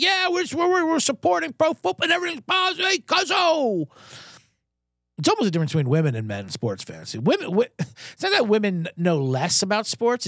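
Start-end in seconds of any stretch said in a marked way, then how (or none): 0.0-3.9 mock positive fan. Mocking McAfee's positive fans, high and gushing. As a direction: gush like an always-positive fan. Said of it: "fans who are positive and like".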